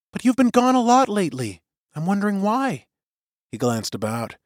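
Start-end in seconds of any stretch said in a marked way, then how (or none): 0.1-2.9 higher, gently pressing woman's voice; worried young woman. A narrator's reading. Suri presses Till, voiced high.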